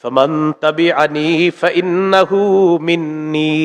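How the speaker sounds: slow melodic chant with long held vowels; drawn-out sung recitation; Quran recitation. Acted.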